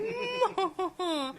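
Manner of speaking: high, drawn-out imitation snooty hoot; snobby "mm-ho-ho". Podcaster imitating the snobby game creature, hooting haughtily. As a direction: hoot like a mock snob. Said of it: "he's all snobby and stuff".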